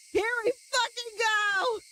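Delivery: high, pumped-up yell to kick things off; excited streamer launching the chapter; hype. Real, not acted. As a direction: fire yourself up to start. Psyching up to start reading.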